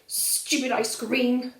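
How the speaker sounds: put-on slow, grumbling sulky child; growling. Valdemar sulking about Papa and the peas, slow growl. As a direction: growl each stupid like a cross toddler. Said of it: "growls Valdemar".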